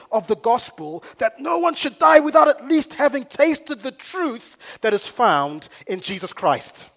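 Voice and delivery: loud, building sermon delivery; impassioned preaching. Real, not acted. Preacher challenging the church to catch a vision, impassioned. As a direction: preach it out, rising to a high, forceful peak.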